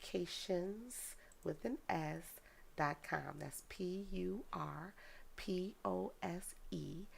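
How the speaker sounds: slow, careful spelling-out; real slow. Real, not acted. Giving viewers a website to write down, real slow. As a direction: spell it out slowly, letter by letter. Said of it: "I want you to say it real slow".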